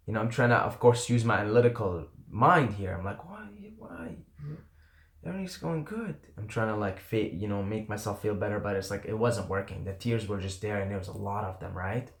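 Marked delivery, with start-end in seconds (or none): 3.3-6.2 soft and high, bewildered; a small, high, hushed why; hushed plea. Retold or imitated. Fighting tears alone, asking himself why, soft and high.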